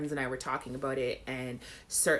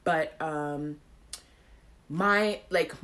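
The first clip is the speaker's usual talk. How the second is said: normal talking voice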